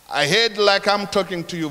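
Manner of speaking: ordinary talk, nothing marked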